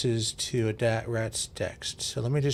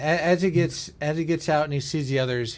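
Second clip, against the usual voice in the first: normal talking voice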